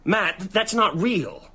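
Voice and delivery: firm denial. Acted. Friend refuses to believe the horror.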